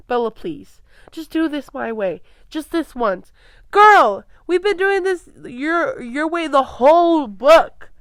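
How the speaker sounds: loud, hammed-up whiny pleading as Edward, stretching "Girl"; mock Edward. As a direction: mockingly beg her to do it his way. Reader mocks Edward's bossy pleading.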